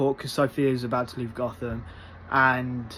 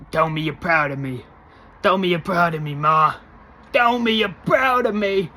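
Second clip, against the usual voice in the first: rising, louder, higher begging; pleading Oz; 4 semitones higher, 6 dB louder than usual. Retold or imitated. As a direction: beg harder each time. Oz begging his mother.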